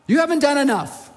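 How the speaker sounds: scarcity mindset complains to God; accusing complaint. Retold or imitated. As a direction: reproach God as if shortchanged. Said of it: "scarcity would say".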